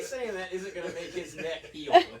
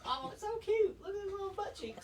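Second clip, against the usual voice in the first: mock cutesy coo; 13 semitones higher than usual. Mocking cutesy gushing.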